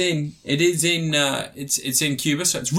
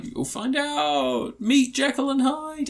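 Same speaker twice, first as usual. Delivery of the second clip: high, slow teasing; hammed-up mysterious friend; 9 semitones higher, much slower than usual. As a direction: draw it out like a cryptic ghost. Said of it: "he's like".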